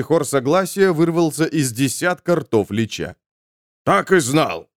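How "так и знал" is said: ordinary delivery, nothing marked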